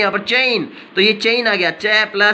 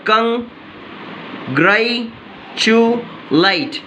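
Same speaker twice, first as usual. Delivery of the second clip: slow model word; 2 semitones higher, much slower than usual. Shown as a demonstration. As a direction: congratulate stretched very slowly, syllable by syllable. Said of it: "the right pronunciation is".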